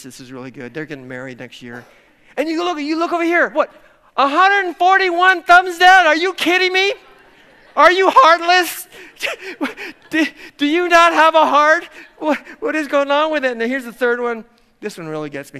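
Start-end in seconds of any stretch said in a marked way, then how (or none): 2.4-13.5 much higher and louder, fast hammed-up disbelief; mock outrage. Pastor mocking thumbs-down voters on wedding videos, mock outrage. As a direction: act scandalised at heartless voters. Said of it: "I'm kind of making fun of this".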